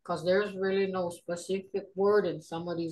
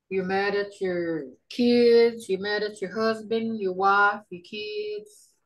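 usual speaking voice

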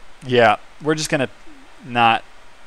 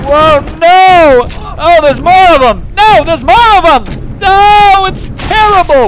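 high, loud alarmed cries; frantic high shouts of alarm; panicked yelling; 12 semitones higher, 7 dB louder than usual. Real, not acted. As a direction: yell in shrill-free panic at the swarm. Panicked at swarm of monsters.

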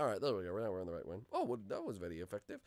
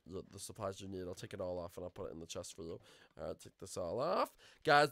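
ordinary talk

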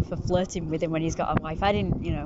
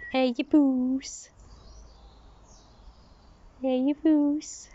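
cat talk; 5 semitones higher, much slower than usual. Real, not acted. Cooing at a cat.

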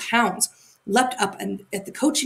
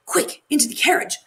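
urging; 7 semitones higher than usual. A narrator's reading. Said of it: "the little robber girl urged Gerda".

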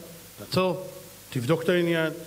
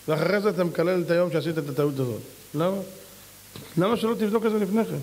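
ordinary delivery

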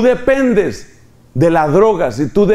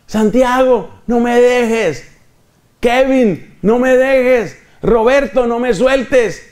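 put-on raised, pleading voice of the old master; pleading pharaoh; 8 semitones higher, slower than usual. The pharaoh begs his slaves to stay, higher pleading voice. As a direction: beg the slave not to leave, pitched high. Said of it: "el faraón ... que está diciendo".

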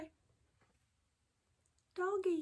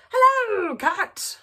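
cheerful duck greeting; 13 semitones higher, 12 dB louder, much slower than usual. Acted. Duck greets cat in story.

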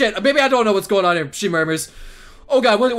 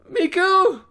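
higher questioning call; tentative calling; 7 semitones higher than usual. Acted. Knocking on her door to see what happens, tentative call.

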